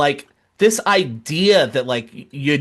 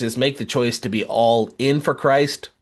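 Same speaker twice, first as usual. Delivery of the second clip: ordinary talk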